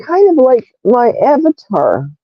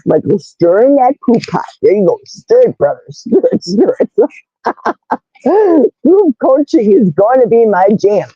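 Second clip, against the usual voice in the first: normal talking voice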